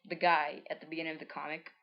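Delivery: ordinary delivery, nothing marked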